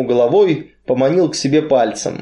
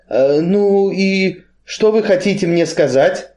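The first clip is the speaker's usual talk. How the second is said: a higher, anxious question; trembling with dread; 6 semitones higher than usual. A narrator's reading. Timid Kain asks the strongman, trembling with dread. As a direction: ask it with fearful anticipation. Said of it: "с трепетом спросил Каин".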